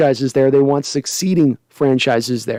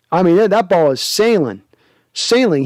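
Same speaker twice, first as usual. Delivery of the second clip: usual speaking voice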